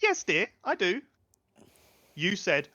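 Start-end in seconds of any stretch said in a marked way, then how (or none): 0.0-1.0 a hint of the higher lady voice; patient, a touch high; lightly voiced grand lady.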